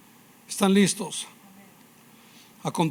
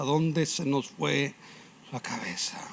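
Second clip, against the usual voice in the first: hushed rhetorical question; 2 semitones lower, 5 dB quieter than usual. Real, not acted. Preacher's rhetorical question to congregation.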